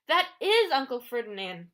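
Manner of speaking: loud, breathless cry of alarm; gasped. A narrator's reading. Grover recognizing his uncle as a statue, gasping in alarm. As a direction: blurt it out in shock. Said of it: "Grover gasped".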